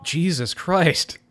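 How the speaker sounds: drawn-out stunned exclamation; slow, stunned disbelief; shocked. Real, not acted. Shocked at the game scene.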